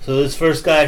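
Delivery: usual speaking voice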